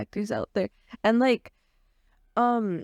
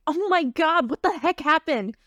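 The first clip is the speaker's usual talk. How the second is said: put-on higher, bright startled girl voice; high-pitched Celestine voice; 7 semitones higher, 4 dB louder than usual. Shown as a demonstration. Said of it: "So she'd be like".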